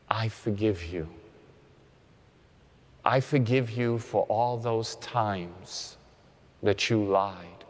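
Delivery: meditative prayer of self-forgiveness; guided prayer. Acted.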